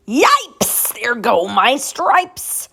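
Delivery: high, loud yelp, then a slow wail; a startled zebra yelping in alarm; zebra yells. Acted. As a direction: yelp it out, then drag the stripes line. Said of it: "The zebra yelled".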